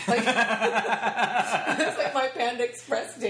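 slow, laughing through the words; giggling over the silly date. Real, not acted. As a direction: laugh as you drag it out.

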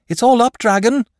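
high, loud breathless shout. A narrator's reading. Boy shouts a warning to the dragon as he runs up. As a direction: yell the news uphill at the beast. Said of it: "he shouted".

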